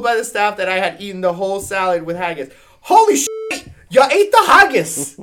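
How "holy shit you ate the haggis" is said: very high, loud mock-Scottish amazement; hammed-up Scottish exclamation at full volume; shouted Scottish accent. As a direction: yell it as an astonished cartoon Scot.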